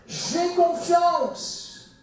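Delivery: high, loud, long-drawn declaration; confident cry. Acted. The man crying out to Jesus, confident. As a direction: proclaim the trust at full voice, slowly. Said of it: "Sa conscience le rattrape".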